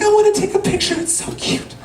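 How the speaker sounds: very high, gushing coo; cooing mom impression. Mom wanting a photo of hugging children, cooing.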